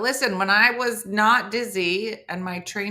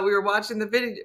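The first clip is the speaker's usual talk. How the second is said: ordinary talk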